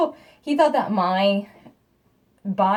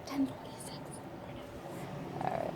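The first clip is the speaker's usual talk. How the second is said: whispered; 6 semitones higher, 17 dB quieter than usual. Real, not acted. Mother checking the clock while husband sleeps, whispered. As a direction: whisper the time.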